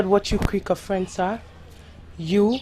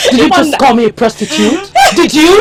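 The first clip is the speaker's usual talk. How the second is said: outraged; 6 semitones higher, 6 dB louder than usual. Acted. Accused of being a prostitute.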